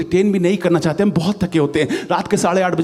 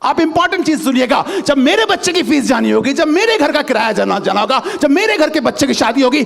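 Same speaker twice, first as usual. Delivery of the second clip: ordinary delivery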